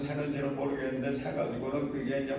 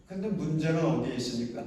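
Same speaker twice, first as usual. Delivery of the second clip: ordinary talk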